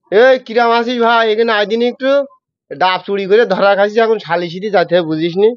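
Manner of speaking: a hint of rural Jessore speech, calling a friend over; light Jessore village dialect, chummy; original Jessore dialect. Shown as a demonstration. Host asks for original Jessore, guest calls a friend in Jessore dialect. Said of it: "original যশোরে কিরকম হবে?".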